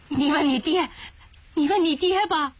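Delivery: high, flustered pleading; frightened old woman. Acted. Old woman too scared to speak, pointing at husband. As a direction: push the question off in panic.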